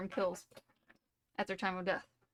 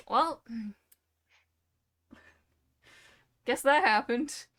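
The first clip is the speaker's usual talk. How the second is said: mock shrug; 3 semitones higher, 7 dB louder, slower than usual. Cops shrugging off colleague's death.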